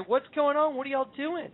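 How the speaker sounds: high-pitched, acted bewilderment; puzzled questioning. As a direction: ask it high, like a worried kid brother. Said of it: "Zen and I say".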